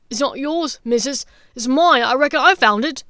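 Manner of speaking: a hint of a coarse thief; snatcher. Voices the snatcher.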